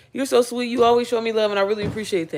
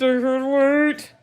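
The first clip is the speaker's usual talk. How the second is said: slow, high, drawn-out squeal of glee; stretched excitement; 6 semitones higher, 3 dB louder, much slower than usual. Real, not acted. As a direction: savor each word, giddy. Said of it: "I'm so excited".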